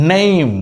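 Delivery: নেম with a long এ; নেম demo; name with এ. Shown as a demonstration. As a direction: stretch the এ in নেম. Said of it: "তার মানে এর উচ্চারণ কি হয়েছে? এ".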